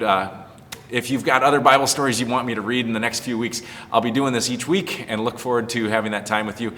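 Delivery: plain reading voice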